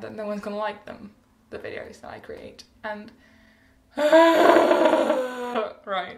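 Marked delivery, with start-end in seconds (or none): 4.0-5.8 long, loud frustrated sigh; exasperated sigh. Real, not acted. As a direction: heave a big, drawn-out sigh. Frustrated about making videos.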